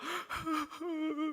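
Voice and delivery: high mock sob as a laugh turns into tears; imitated sob. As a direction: let the laugh break into a high fake sob. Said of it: "이렇게 울어요".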